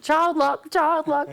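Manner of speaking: put-on high, singsong child lock; high teasing warning, repeated; mimicking Raquel. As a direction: tease him in a high sing-song. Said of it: "she's like".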